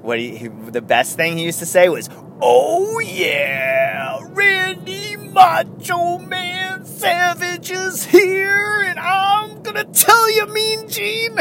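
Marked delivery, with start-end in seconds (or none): 2.4-11.4 high, drawn-out gravelly wrestler growl; Macho Man impression. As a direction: stretch every word like a hyped wrestler promo. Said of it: "do an impersonation of him".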